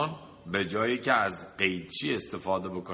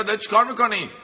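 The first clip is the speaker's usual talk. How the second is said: startled outcry; 9 semitones higher, 6 dB louder, much faster than usual. Acted. Owner startled by the bang.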